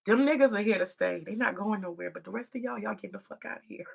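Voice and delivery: ordinary talk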